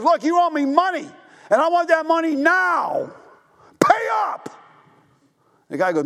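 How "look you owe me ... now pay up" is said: high, pushy demand for payment; demanding creditor. Retold or imitated. Forgiven servant shaking down his fellow servant, high and pushy. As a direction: lean on him, you want your money now.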